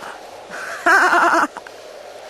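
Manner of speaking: high, breathy witch cackle; evil witch cackle over the cauldron; wicked cackle. A narrator's reading. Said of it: "with a wicked laugh".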